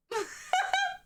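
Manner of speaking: very high burst of laughter. Real, not acted. Teller cracking up at the kid impression, high laugh. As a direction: squeal with laughter.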